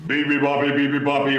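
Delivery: hammed-up lion roar; mock roar. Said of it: "roared the lion".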